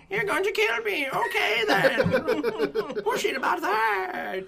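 put-on very high, slow, cackling accent; German alien voice. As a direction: gloat like a hammy alien overlord. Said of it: "this gives my alien, uh, some weight".

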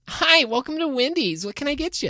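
high, chirpy greeting voice; high cashier voice. Acted. New worker greeting.

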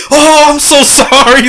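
slow, high, sorry-for-you voice; apologetic cat owner. Retold or imitated. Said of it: "the lady's like".

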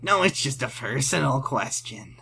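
lightly voiced sleazy creep; a hint of a leering creep, offhand. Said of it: "why you said that in creep voice".

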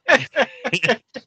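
high, loud burst of laughter; high real laugh; big laugh. Real, not acted. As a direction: crack up for real. Everyone cracking up.

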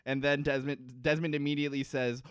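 normal talking voice

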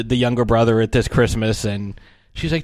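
ordinary talk

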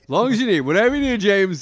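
high, loud eager-to-please actor. Acted. Eager actor telling the director he's there for him, gushing.

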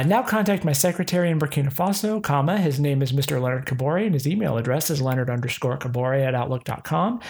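hurried re-read, every parenthetical spoken; fast reread. Shown as a demonstration. Rereading the scam email for viewers, fast and hurried. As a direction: rattle it off fast with the punctuation. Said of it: "I've gotta read that again".

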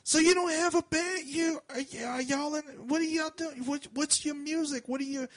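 put-on high-pitched rambling drunk woman; high drunk woman firing questions. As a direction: babble questions like the drunk lady. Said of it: "she's like".

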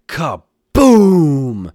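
big booming shout, long falling vowel; storyteller's drawn-out bomb blast; loud explosion. A narrator's reading. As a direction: burst out high and very loud. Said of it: "The bomb exploded loudly".

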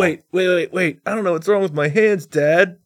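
put-on high, panicky teen voice; mock panicked kid; panicky son. Son panics over his hands.

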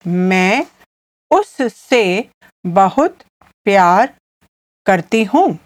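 slow teaching pace with gaps between words; slowly. Shown as a demonstration. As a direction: lay the words out one at a time. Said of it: "I'll say it again slowly".

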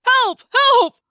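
high, loud squeals of panic; yelping cry for help. A narrator's reading. Human prey fleeing a predator, loud yelping cry. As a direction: shriek for help like hunted prey. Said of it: "Loud, yelping vocalizations".

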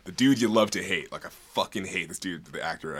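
ordinary delivery

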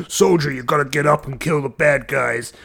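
loud, urgent army-sergeant call; urgent soldier shout. Retold or imitated. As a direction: bark it like a battlefield NPC. Game NPC urging soldier.

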